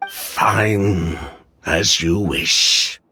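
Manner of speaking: slow, reluctant concession; resigned. Acted. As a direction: give in slowly, voice dropping. Reluctantly gives in.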